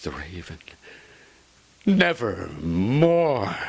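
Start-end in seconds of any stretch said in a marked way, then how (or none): 1.9-3.6 slow, eerie altered croak; raven's voice. Acted. The raven answering the lover with one word. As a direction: draw the word out like a bird of doom.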